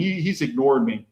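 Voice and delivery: usual speaking voice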